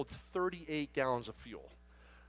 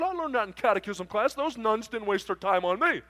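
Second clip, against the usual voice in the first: proud trucker character; 6 semitones higher, 5 dB louder than usual. Retold or imitated. Trucker proudly credits the nuns.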